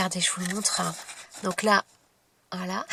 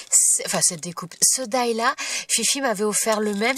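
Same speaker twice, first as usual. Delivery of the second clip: ordinary talk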